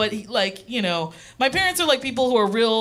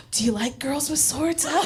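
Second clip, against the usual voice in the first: imitating mom; 4 semitones higher than usual. Mom probing in a story.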